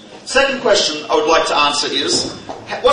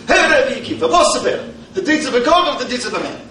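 cocky, higher, fast, light mock Italian accent; mock Italian Roman; 6 semitones higher, faster than usual. Roman challenges the rabbi, light mock Italian accent. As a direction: swagger the question in a hint of Italian accent. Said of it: "I don't know if he had an Italian accent".

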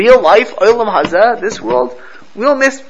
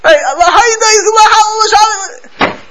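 high, loud ecstatic cry; screaming prayer; 7 dB louder, slower than usual. Retold or imitated. Rabbi acting out chassid screaming Nishmas in shul. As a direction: cry it out to the heavens. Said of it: "He starts screaming like".

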